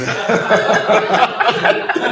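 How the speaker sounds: a burst of high, loud laughter at himself. Real, not acted. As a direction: laugh out high at your own slip. Laughs at own accidental accent.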